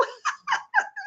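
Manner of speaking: high, light laugh; quick giggle. Real, not acted. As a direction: laugh at your own remark. Laughs at own remark.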